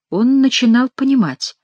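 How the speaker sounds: usual speaking voice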